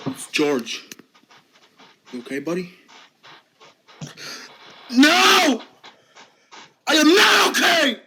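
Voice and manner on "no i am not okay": higher, louder, yelling in distress; upset shout. Acted. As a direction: burst out at your friend. Finn breaks down to George.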